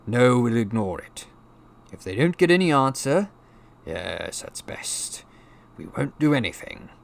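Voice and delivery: uncle lightly voiced, thinking aloud; decide it piece by piece, trailing then firm; decisive Uncle Vernon. A narrator's reading. Uncle deciding to ignore the letter, uneven resolve.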